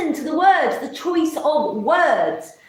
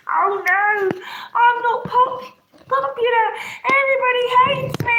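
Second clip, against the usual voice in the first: much higher, whiny mock despair; mock self-pity; 8 semitones higher than usual. Nobody joins her stream, hammed-up whiny self-pity, much higher. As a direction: whine it like a hammed-up tragic victim.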